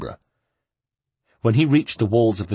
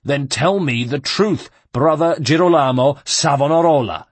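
menacing guard; 5 semitones higher, 5 dB louder than usual. Acted. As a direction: drag out his name like a threat. Guard demands the truth.

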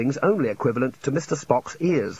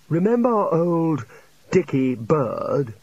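very slow, drawn-out character voice; reminiscing old academic; 4 semitones higher, much slower than usual. Acted. Old academic reminiscing to colleagues, slow and drawn out. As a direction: dwell on each word of a fond recollection.

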